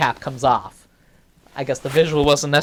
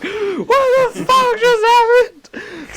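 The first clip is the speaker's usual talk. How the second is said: very high, drawn-out cry of disbelief; acted shocked outburst; 4 dB louder, much slower than usual. Storyteller left alone after the whisper, high shocked outburst. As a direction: stretch it into a high, stunned wail.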